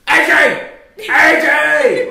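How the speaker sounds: high, loud yelled name; screaming fan. Retold or imitated. Wife screaming for a wrestler, high loud shout. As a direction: shout it across the arena. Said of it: "Nikki's screaming her lungs out".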